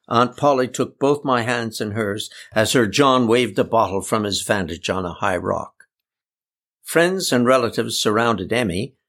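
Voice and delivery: ordinary delivery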